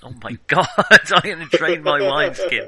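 laughing. Real, not acted. Laughing at the drunk joke.

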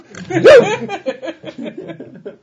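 loud high burst of laughter. Real, not acted. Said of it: "That's, that's their whole German talk".

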